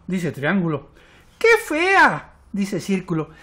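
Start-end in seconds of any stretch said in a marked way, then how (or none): 1.4-2.3 high, loud jeer; mocking disgust. A narrator's reading. Circle insulting the little blot, mocking. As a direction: exclaim it high with open scorn. Shapes mock the newcomer.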